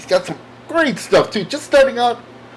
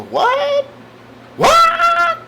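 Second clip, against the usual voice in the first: very high squeaked "What?", louder the second time; mock shock; 4 dB louder than usual. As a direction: squeal it in hammed-up disbelief. Acted shock at better prices.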